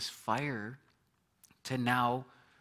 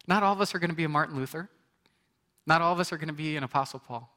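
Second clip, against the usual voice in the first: fast, raised, louder preaching; 4 semitones higher, 5 dB louder, faster than usual. Real, not acted. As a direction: drive the point home to the congregation. Preaching at full force.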